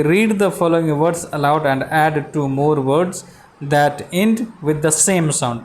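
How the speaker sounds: very slow, word-by-word reading of the task; slow classroom reading of the English task; slow reading aloud. Shown as a demonstration. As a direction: read the instruction slowly so pupils follow. Teacher reads instruction aloud.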